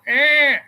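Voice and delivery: high, sharp cat yowl; a strained cat mewl, loud and high; imitated cat cry. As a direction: squeal it like a cat in distress. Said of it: "we hear this cat noise going".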